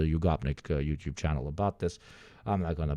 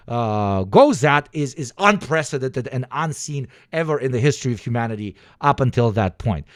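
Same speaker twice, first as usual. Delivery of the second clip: higher, louder lecturing rant; heated rant; 6 semitones higher, 8 dB louder than usual. Real, not acted. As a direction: preach it at full tilt.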